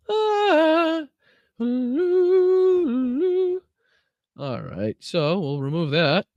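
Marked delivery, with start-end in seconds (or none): 0.1-3.6 squeaky falsetto oohs. Real, not acted. Reacting to the squeaky clip audio.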